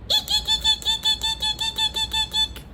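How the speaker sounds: high squeaky eeks; squeaky bat voice. Shown as a demonstration. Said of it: "very squeaky and they're very high-pitched".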